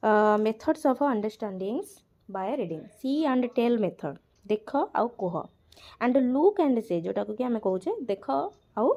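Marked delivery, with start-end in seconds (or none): none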